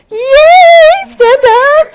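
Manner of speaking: loud, high cheering yay; excited cheer. Real, not acted. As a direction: cheer her on to stand up. Cheering a guest presenter.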